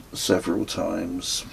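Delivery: ordinary delivery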